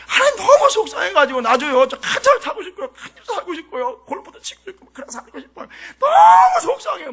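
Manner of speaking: loud, hammed-up whining prayer; mock tearful complaint. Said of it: "막 눈물이 막 나더라고요". (울부짖듯 과장된 하소연)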